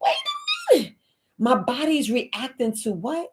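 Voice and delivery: high, stretched "wait a minute"; surprised double take. Retold or imitated. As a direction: freeze in amazement. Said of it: "and be like".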